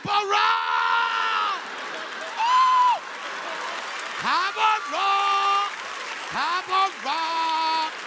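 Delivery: high, loud, long drawn-out cheers; screaming fan. Retold or imitated. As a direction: scream it like a smitten rally-goer. Acts out himself as gushing rally fan.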